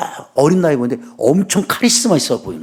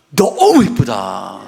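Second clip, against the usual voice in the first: slow tender compliment; 5 semitones lower, much slower than usual. Shown as a demonstration. Preacher showing husbands how to praise, slow and tender. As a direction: linger on each word lovingly. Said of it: "한번 해봐요, 남자들".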